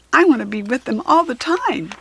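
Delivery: longing to be with animals; excited young girl; eager girl. Acted. Kirsty voiced in story.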